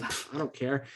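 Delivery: soft, low-key brush-off; acting cool. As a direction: toss it off quietly, mock-cool. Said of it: "like, acting all cool".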